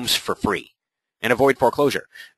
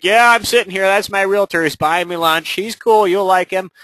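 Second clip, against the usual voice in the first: much higher, louder friendly vouching; Mike vouching; 10 semitones higher, 6 dB louder than usual. Pretend. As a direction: vouch for your realtor, pitched up and loud. Mike voiced vouching for agent.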